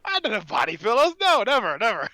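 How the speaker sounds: high, loud hammed-up protest; mock indignant denial. Host caught out about body pillows, mock-indignant denial. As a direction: deny it far too loudly, in jest.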